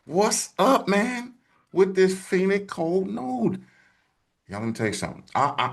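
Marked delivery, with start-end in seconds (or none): 0.0-3.6 high, slow voice of a curious viewer; imitated viewer question. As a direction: raise the pitch and drawl out the fan's question. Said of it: "someone said".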